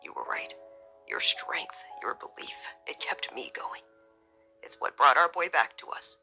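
low, hushed, heartfelt; tender near-whisper. A narrator's reading. Husband thanking his wife, hushed and tender.

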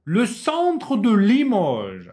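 Le centre de Limoges said slowly and loud; slow model reading. Shown as a demonstration. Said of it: "Bu bir kelime bütünüdür".

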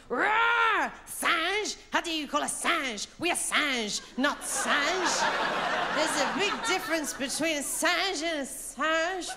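high, indignant gorilla with a roar; big gorilla. Retold or imitated. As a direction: protest in high-pitched mock outrage. Gorilla scene replayed with French word.

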